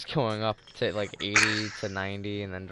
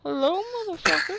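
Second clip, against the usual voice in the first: very high, loud mocking taunt; raging gamer; 6 dB louder than usual. Real, not acted. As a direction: taunt the enemy gleefully. Taunting enemy.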